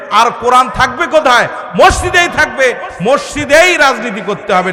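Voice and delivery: loud, punchy mosque sermon; rally the congregation with forceful declarations; full-force preaching. Acted.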